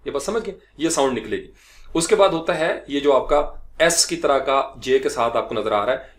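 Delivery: ordinary talk, nothing marked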